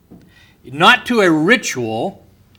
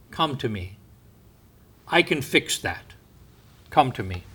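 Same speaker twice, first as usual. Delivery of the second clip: very soft, low, tender call; tender invitation; 4 semitones lower, 9 dB quieter than usual. Acted. Preacher voicing Jesus to the lost, hushed and tender. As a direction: draw the lost in gently, almost hushed.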